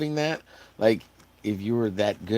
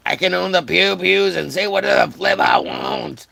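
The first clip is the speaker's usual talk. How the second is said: loud, high, hammed-up bragging; mock patriotic shout; 8 semitones higher, 6 dB louder than usual. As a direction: brag like a loud cartoon patriot. Mock proud American rant.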